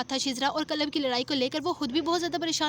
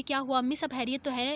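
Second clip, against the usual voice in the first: usual speaking voice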